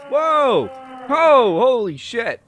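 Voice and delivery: loud, high shocked whoas; startled outburst. Real, not acted. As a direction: cry out in startled disbelief. Startled by events in the game.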